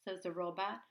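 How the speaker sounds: ordinary delivery, nothing marked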